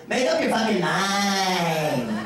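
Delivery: drawn-out mock complaint in a little voice; comic little voice from behind, stretched out. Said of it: "I hear this little voice go".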